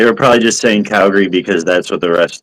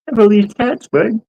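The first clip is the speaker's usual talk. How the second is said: much higher, squeaky put-on Bubbles voice; a laughing, half-hearted try at Bubbles; high cartoonish Bubbles voice, breaking into a laugh; Bubbles impression attempt; much slower than usual. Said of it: "I can't even do it".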